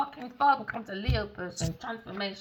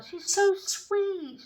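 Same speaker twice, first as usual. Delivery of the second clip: gushing; 12 semitones higher, much slower than usual. Retold or imitated. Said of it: "I said".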